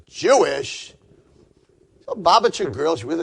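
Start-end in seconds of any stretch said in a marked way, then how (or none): 0.1-0.9 incredulous echo. Retold or imitated. Sister incredulous at question.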